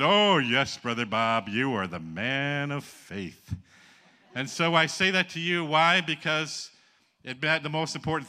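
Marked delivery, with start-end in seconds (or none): 0.0-3.5 loud "oh, yes", drawn-out sarcastic finish; mock admiration. Pastor teasing Brother Bob's boast, mock admiration. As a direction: gush with teasing reverence.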